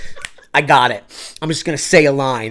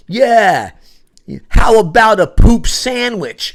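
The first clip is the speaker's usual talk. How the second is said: slow, loud, higher yell; yelled ad-lib; 6 semitones higher, 7 dB louder, much slower than usual. Retold or imitated. Shouting an ad-lib at the scene, slow loud yell. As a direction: yell it out across the stage. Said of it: "I yell out".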